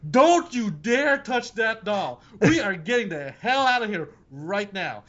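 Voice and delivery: slow, stern and emphatic; stern warning. Retold or imitated. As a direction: lay down the law slowly. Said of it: "I'm like".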